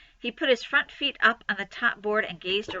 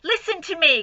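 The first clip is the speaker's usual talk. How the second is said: high, loud urgent cry; cried out; 7 semitones higher, 5 dB louder than usual. Acted. Wilbur crying out to Templeton, high and loud. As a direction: cry for the rat to listen. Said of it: "cried Wilbur".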